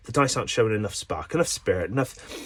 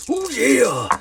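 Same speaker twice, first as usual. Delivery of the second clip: macho self; 13 semitones higher, 5 dB louder than usual. Acted. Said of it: "the macho me".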